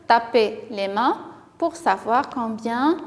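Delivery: ordinary talk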